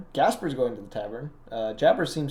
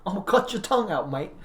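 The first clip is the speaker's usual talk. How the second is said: high mock South London threat through a laugh; laugh-cracked South London tough; South London accent; 7 semitones higher than usual. Joking South London insults, mock menace with laughter. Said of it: "South London, yeah?".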